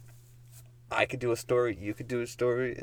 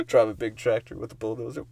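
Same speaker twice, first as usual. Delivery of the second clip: usual speaking voice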